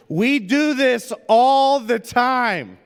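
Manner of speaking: loud, high, slow, cheeky drawn-out comeback; smart-aleck retort. Retold or imitated. Son firing back at his sister, cheeky loud retort. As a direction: sass it right back at her. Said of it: "smart alecks, just fired back".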